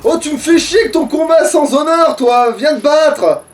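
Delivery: ordinary delivery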